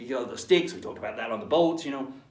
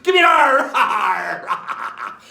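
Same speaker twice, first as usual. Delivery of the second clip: loud, high rallying call; pirate shout; 10 semitones higher, 11 dB louder, much slower than usual. Acted. Teacher rousing the class like pirates, loud shout. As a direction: rouse the crew with a big shout. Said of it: "pirate call to the class".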